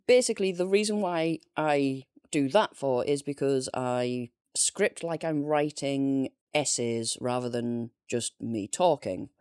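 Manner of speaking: normal talking voice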